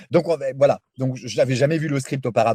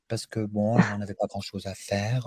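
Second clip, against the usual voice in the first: put-on a touch of a bored young heroine, softer; lazy, careless ingenue voice, spoken softly; blasé jeune première; 3 semitones lower, 7 dB quieter than usual. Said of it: "parlait un peu comme ça". (voix lasse et détachée de jeune première, plus douce)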